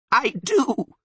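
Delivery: very high, loud bleat; bleating Sylvan. A narrator's reading. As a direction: bleat it back emphatically. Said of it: "bleated the Sylvan".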